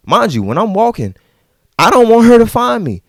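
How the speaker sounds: ordinary delivery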